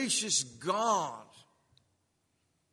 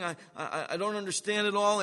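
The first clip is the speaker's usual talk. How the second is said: ordinary talk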